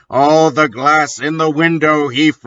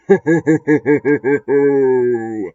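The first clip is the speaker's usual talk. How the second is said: lightly hammed villain chuckle; crude laugh. The villain laughs crudely at her begging. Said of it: "He laughed crudely".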